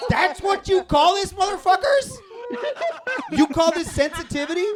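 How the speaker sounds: outraged yell. Retold or imitated. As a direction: yell at them in disbelief. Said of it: "I was like".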